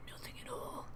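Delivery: soft whispered aside. Real, not acted. Gamer muttering during play, a whisper. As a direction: breathe it to yourself. Murmuring aside while playing.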